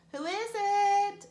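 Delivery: high, slow fake old lady voice; a wolf faking grandma's voice; strange grandma-like voice. Voice behind the door pretending to be grandma. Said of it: "It was a strange voice".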